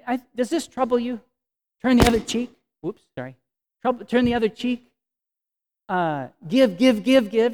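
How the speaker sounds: pushing the congregation to give; insistent. Acted. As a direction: press each give harder. Preacher pressing the point.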